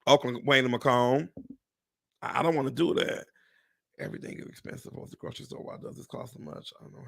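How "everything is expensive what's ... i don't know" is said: unvoiced whispered grumble. Real, not acted. Grumbling to viewers about grocery prices, whispered. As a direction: mutter it under the breath.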